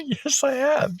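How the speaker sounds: insisting. Retold or imitated. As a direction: squeal it back in mock protest. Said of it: "I go".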